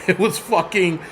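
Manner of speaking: ordinary delivery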